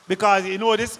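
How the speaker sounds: ordinary talk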